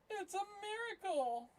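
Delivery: high, drawn-out wonder; mock awe. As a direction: sing out the miracle in mock awe. Gold appearing, mock awe.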